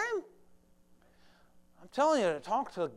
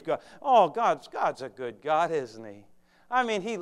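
usual speaking voice

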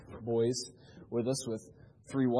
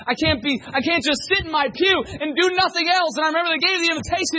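high, loud, urgent holler; preaching at full force; 14 semitones higher, 11 dB louder than usual. Real, not acted. Young man who must do something, fervent shouted preaching. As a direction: push it out as a desperate, rising pulpit shout.

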